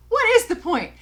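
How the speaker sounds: loud, high-pitched exasperation; exasperated outburst. Real, not acted. Exasperated repeat of the question.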